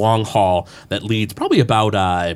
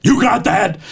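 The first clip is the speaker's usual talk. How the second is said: raised, higher-pitched villain demand; gruff boss barking; 10 semitones higher than usual. Acted. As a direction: snap at the henchman as the angry boss. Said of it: "gruff voice".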